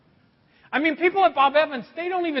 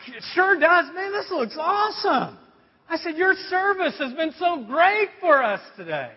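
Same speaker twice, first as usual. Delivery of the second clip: gushing praise, pitched up high; overdone enthusiasm; 6 semitones higher than usual. Retold or imitated. Customer trying to make grumpy waitress smile, gushing high. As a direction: lay on the cheer, higher.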